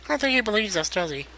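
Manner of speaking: a small worried sprite; high fairy voice. Acted. Fairy companion doubting the captain, high soft voice.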